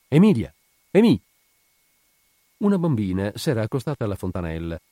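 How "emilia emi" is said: high, loud call down the street. A narrator's reading. Woman on the doorstep calling the little girl, calling out. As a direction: shout the name to someone far off. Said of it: "guardò nella strada e chiamò".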